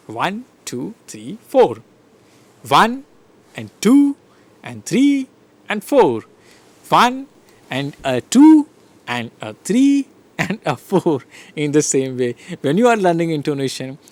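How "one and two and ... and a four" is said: beats counted out high and loud; counted rhythm. Shown as a demonstration. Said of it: "the same rhythm that you learnt".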